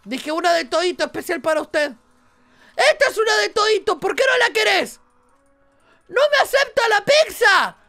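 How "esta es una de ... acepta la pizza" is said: high, louder indignant complaint; outraged the customer refuses; frustrated yell. Real, not acted. (queja indignada, aguda y fuerte)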